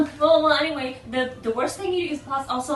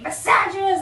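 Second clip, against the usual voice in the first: high, louder hammed-up accusation; mock scream; 7 semitones higher, 5 dB louder than usual. Lady yelling on her way off the bus, mock scream. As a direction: hurl it as an imitated parting yell. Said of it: "yelling and screaming".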